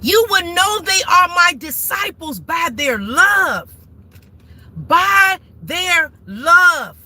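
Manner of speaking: fiery sermon delivered from the driver's seat; preaching. Real, not acted. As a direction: preach it, leaning on each word. Preaching to viewers from car.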